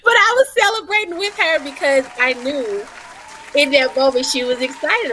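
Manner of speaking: fighting back happy tears; choked up. Real, not acted. Moved to tears telling the hosts about it, a real catch in her voice. Tearful over moms' messages.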